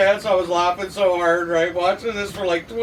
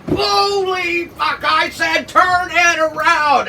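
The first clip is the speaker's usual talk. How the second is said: drawn-out high yell, every word stretched; slow furious shout; 10 semitones higher, 3 dB louder, slower than usual. Retold or imitated. Boss storming up the scaffold at nephew, slow furious shout. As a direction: bellow it after the crash. Boss yelling after the fall.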